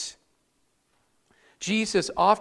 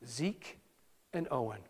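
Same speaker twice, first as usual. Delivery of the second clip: much softer, low, gently pleading the names; soft pleading call; 6 semitones lower, 13 dB quieter than usual. Shown as a demonstration. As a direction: plead quietly with the boys. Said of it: "Am I pleading?".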